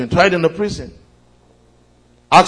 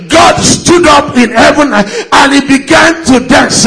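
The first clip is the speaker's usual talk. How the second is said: rising fervent sermon voice; preaching; 9 semitones higher, 12 dB louder than usual. Acted. Preaching at full force.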